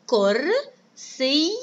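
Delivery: الكرسي in four separate beats, al - kur - siy - yi; slow الكرسي, pausing after each syllable; split into syllables. Shown as a demonstration. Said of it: "نقطع هذه الكلمة المشددة".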